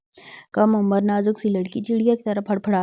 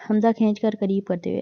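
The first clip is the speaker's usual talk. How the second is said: ordinary delivery, nothing marked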